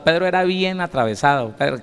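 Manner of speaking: usual speaking voice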